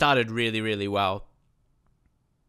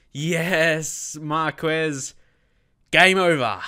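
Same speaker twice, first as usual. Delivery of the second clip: higher and louder cheer; goal celebration; 4 semitones higher, 6 dB louder, much slower than usual. Real, not acted. Goal sealing the match.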